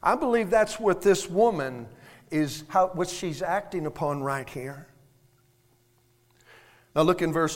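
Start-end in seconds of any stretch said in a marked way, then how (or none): none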